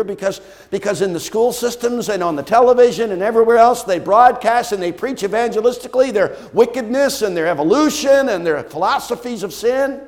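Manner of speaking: loud, high indignant preaching; full-force preaching. Acted. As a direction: preach it hard against wickedness.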